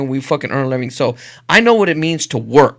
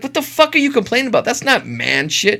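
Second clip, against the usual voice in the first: scoffing retort; 6 semitones higher than usual. Retold or imitated. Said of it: "I'm like".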